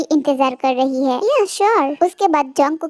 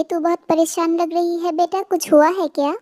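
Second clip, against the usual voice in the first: ordinary delivery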